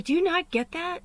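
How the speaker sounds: retold exasperated rant, high and loud; going off on someone. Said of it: "kinda went off on me".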